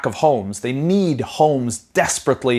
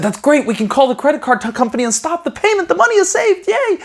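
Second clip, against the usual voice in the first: much higher, squeaky mock-cheerful voice, rising; mock the parent's giddy relief in a squeaky voice; squeaky relieved parent; 14 semitones higher than usual. Son mimics parent celebrating the stopped payment, squeaky and mocking. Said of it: "He said".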